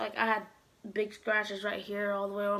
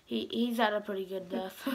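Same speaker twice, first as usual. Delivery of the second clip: ordinary talk, nothing marked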